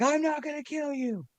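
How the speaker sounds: mock lunatic pleading way up high; crazed madman. Co-host imitates crazed McCoy.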